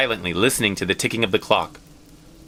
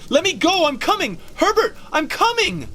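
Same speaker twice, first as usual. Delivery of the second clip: much higher, desperate calling out; frantic struggling cry. Acted. Mother calling to her son at the door, frantic. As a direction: call to the son while pulling free.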